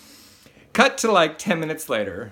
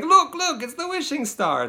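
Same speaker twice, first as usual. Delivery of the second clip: Geppetto spotting a star; 14 semitones higher than usual. Retold or imitated. Geppetto showing Pinocchio the wishing star, excitedly. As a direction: gasp it out like Geppetto pointing at the sky. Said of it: "he's like".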